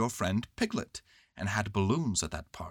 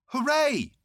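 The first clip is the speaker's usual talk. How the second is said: high, loud hooray; shouted cheer; 6 dB louder than usual. A narrator's reading. Boy cheering balloon's rise, high shout. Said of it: "you shouted".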